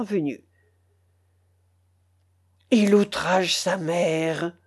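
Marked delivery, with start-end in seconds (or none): 2.7-4.6 low, slow stern pronouncement; the general's wife, slow and severe, scolding her son. A narrator's reading. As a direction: pass sentence on him gravely. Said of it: "avec une grande sévérité".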